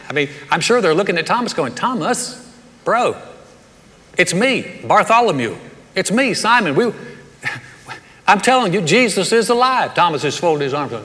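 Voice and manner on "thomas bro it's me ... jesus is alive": higher-pitched, incredulous coaxing of a friend; acted-out earnest persuading, pitched up; imitated pleading. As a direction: plead with a doubting buddy. Acts disciples pleading with Thomas.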